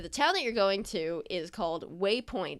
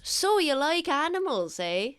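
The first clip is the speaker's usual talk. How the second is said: higher, chatty voice for the husband making conversation; friendly older husband; 7 semitones higher than usual. Acted. Husband turns to chat with the passenger, friendly and higher. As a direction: play the husband turning to the passenger with a light question. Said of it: "and goes".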